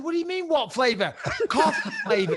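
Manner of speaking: high, loud exasperated disbelief; wound up. Retold or imitated. Customer retelling his answer to the server, exasperated. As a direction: throw it back in mock outrage. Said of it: "starting to wind myself up".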